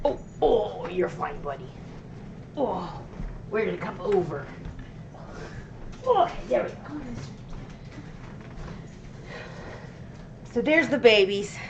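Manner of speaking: high cooing to pets; pet talk. Real, not acted. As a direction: soothe the little animal, sing-song.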